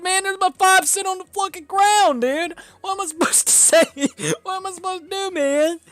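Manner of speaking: high-pitched, loud panicky plea; hammed-up frightened voice; mock panicked guy. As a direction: squeak it out like a cornered guy begging. Acting out scared man's reaction.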